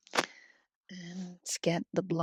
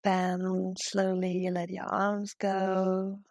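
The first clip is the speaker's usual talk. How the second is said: guided meditation. Real, not acted. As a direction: guide them gently into breathing, unhurried. Leads a heart-centred yoga meditation.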